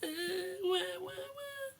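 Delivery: high fake wah-wah wailing; mock crying. Parent doing a pretend fox cry for the child. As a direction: ham up a babyish cry. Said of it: "your best crying face".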